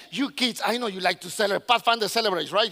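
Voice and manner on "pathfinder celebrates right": normal talking voice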